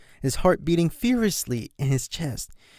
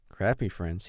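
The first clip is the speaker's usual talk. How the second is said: softer, lower aside to yourself; muttered aside; 5 semitones lower, 6 dB quieter than usual. Acted. Brother to himself, soft lower mutter. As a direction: mutter it under your breath. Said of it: "he added to himself".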